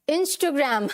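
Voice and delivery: Instagram as "in-stuh-gram"; short middle "stuh" in Instagram, English "gram"; corrected pronunciation. Shown as a demonstration. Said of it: "क्या बोलेंगे?".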